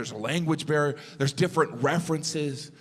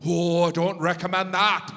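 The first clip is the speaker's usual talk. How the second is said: put-on Irish accent, pitched up; higher-pitched mock Irish accent; Irish sound guy; 3 semitones higher than usual. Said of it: "the sound guy was like".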